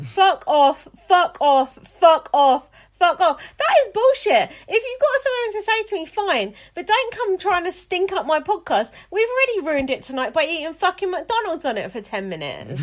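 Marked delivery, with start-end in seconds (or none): 0.0-3.4 each fuck off louder than the last; building shout. Real, not acted. Repeated curse, building louder. 3.6-11.1 loud, furious swearing rant; angry rant. Real, not acted. Host furious about a rude email.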